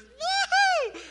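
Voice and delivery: very high, loud whooping cheer; shrill party whoop; woohoo cheer. Retold or imitated. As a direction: whoop like a crowd of teenage boys. All the guys cheering.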